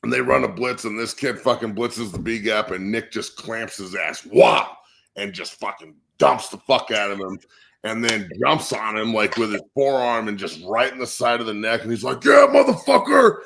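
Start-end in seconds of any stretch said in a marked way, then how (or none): 4.2-4.8 sudden loud smack of a word; imitated impact. Imitates the hit in story. 12.2-13.4 high, loud imitated battle yell; triumphant yell. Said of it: "you can hear it everywhere".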